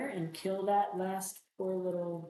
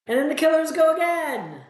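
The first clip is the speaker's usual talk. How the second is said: loud, high-pitched announcement; exasperated shout; 11 semitones higher, 13 dB louder than usual. Real, not acted. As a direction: cry it out in dismay at the next turn. Killers get another turn.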